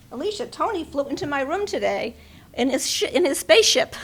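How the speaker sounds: lively imitation of an old friend's joke; quoting Ellie. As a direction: relay the friend's line with growing glee. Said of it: "And she said".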